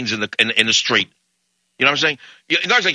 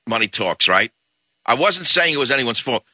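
normal talking voice

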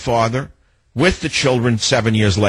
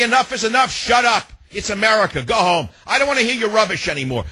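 shouted retort; 8 semitones higher than usual. Pretend. Host urging listeners, shouting the line they should say. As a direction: bark it out like telling someone off. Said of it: "Just say".

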